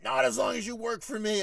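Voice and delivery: high, loud imitation pulling rank; heated mock authority; retold shouting boss. Boss shouts back in retold row.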